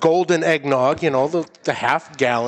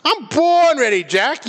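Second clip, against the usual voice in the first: cocky hype; 10 semitones higher, slower than usual. Real, not acted. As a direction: pump yourself up for the contest. Boasting before chug challenge.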